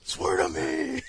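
high, strained mock yell; exaggerated growly superhero interrogation shout; Batman. Mocking the movie hero to his co-host, growly yell. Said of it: "it's just the way he's yelling at".

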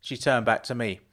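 ordinary delivery

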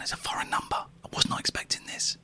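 hushed nervous whisper. Real, not acted. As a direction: whisper so the line can't hear. Hiding from call recipient.

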